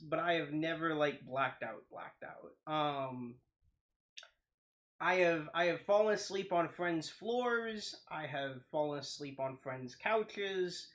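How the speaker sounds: ordinary delivery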